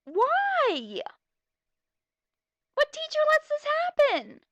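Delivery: loud, high shriek of disbelief; outraged shout. Real, not acted. Reader shrieks at the absurd classroom song, loud and high. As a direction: scream it in disbelief.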